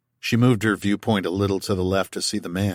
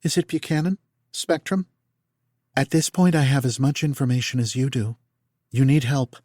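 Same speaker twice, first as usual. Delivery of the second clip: lightly voiced higher, even machine calm; 5 semitones higher than usual. A narrator's reading. Nick reporting to Tal, lightly voiced calm AI. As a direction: speak like a detached medical AI.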